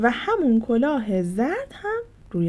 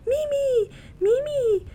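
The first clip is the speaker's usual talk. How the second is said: shouting a name; slower than usual. Acted. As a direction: call her friend from afar. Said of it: "فریاد زد".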